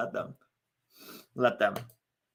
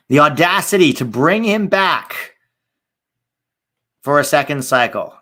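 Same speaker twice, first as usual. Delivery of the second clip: outraged; 3 semitones higher, 11 dB louder than usual. Real, not acted. Streamer ranting about the coach's rehiring, loud outrage. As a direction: cry out in indignation, voice climbing.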